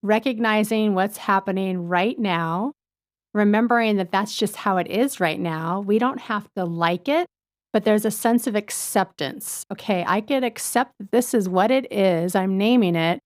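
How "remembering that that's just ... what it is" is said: ordinary talk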